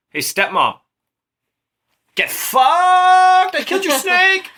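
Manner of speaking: hammed-up victory shout at the stepmom; triumphant yell. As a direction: gloat at full volume. Apollo taunts his stepmom.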